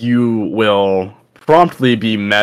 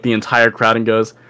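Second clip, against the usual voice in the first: ordinary talk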